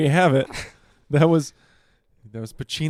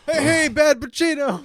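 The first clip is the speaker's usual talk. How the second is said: calling out; 12 semitones higher, 6 dB louder than usual. Acted. Calling out mock crowd.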